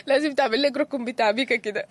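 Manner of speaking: laughing through the words; chuckling while teasing the host. Real, not acted. Joking about a crying corner. (بضحك)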